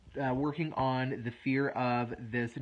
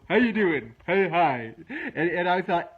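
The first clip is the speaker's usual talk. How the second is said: high, loud, cheery cartoon greeting; goofy cliff greeting; 4 semitones higher, 7 dB louder than usual. A goofy cliff greets people near its edge, high and loud. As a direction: play a goofy friendly cliff saying hello. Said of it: "this really goofy looking face".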